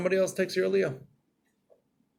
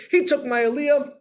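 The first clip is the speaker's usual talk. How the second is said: indignant; 10 semitones higher, 7 dB louder than usual. Retold or imitated. Upset man's angry inner thought.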